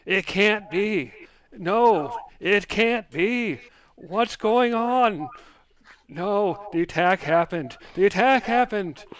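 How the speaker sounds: high, loud wail of disbelief; normally chipper voice, distressed. Acted. Game master voicing the voice in Ayer's head, distraught. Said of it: "what's normally a chipper voice say".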